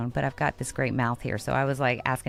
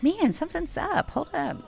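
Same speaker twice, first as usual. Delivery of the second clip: puzzled alarm; 11 semitones higher than usual. Retold or imitated. Noticing her heel is broken.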